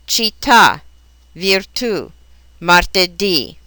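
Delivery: final stress: cit-TÀ, vir-TÙ, mar-te-DÌ; stress accented syllable. Shown as a demonstration. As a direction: land on the last syllable of "città, virtù, martedì". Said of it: "stress goes on the syllable containing".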